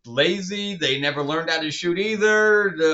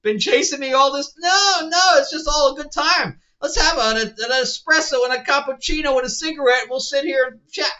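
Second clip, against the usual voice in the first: higher, louder, over-the-top gushing catch-up talk; mock gushing chatter; 10 semitones higher, 6 dB louder than usual. Reviewer mocking the characters' cheerful reunion, hammed-up gushing. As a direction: ham it up like old friends gossiping over coffee. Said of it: "They're all sitting there going".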